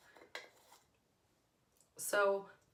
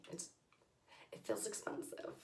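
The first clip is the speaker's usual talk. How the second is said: whisper; 14 dB quieter than usual. Real, not acted. Hushed remark about weighty packaging.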